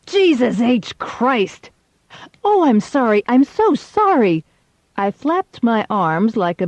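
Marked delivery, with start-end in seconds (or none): 0.0-1.7 slow, strained outcry of pain; pained curse. Acted. Pitcher dropped on his foot. 2.4-4.4 high, loud gushing apology; hammed-up frantic apology; mock apology. Feigned apology after the mess.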